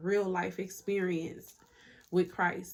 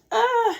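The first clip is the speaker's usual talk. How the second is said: sudden high yelp; 11 dB louder than usual. Real, not acted. Loud outburst before "anyway.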